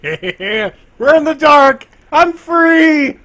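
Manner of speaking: high, slow, very loud cry; manic shout. Acted. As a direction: yell out in wild glee. Shrieking excitedly at perception check.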